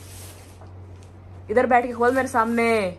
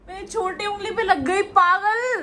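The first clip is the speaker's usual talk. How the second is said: laughing in pain; 9 semitones higher, slower than usual. Real, not acted. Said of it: "कौन सी size छोटी है?".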